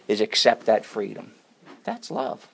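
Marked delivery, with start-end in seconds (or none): 1.8-2.5 hushed. Real, not acted. Awed aside after climax.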